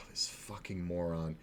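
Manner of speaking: a sighing, breathy whispered insult; exasperated whisper. Real, not acted. Storyteller mutters about the foolish dealer, real exasperated whisper. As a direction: hiss it under your breath in disbelief.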